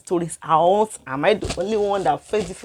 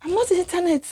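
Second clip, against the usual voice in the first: shocked; 10 semitones higher, faster than usual. Retold or imitated. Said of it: "I was shocked".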